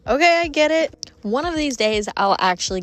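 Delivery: retold moment of being convinced, pitched high and emphatic; won-over reaction. Traveler retelling her first awe at Grand Canyon, won over.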